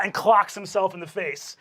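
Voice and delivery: usual speaking voice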